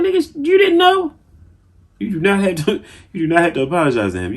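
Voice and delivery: high, loud exasperated outburst; exasperated yell. Real, not acted. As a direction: shout at the screen in disbelief. Yelling at Will on screen.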